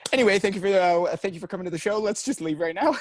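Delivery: flustered. Retold or imitated. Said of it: "I had to quickly be like".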